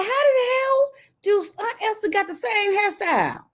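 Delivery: high-pitched comic disbelief; hammed-up exasperated questioning; mock outrage. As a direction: scold her in a high, incredulous voice. Mock scolding the woman in photo.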